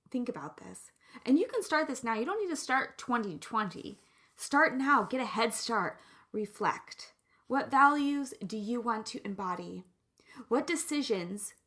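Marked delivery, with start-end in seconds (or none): none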